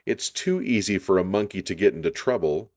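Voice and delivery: ordinary delivery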